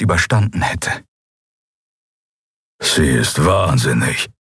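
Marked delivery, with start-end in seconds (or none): 2.8-4.3 low, slow growled murmur; deep, unhurried creature voice; rumbling shadow creature. A narrator's reading. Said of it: "brummte der Schattenskrier".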